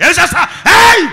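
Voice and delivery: high, loud furious yell; angry shout. Retold or imitated. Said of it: "ఆ కత్తి తీసుకొని వచ్చేసాడట". (కత్తి పట్టుకొని భార్యపై కోపంగా అరుపు)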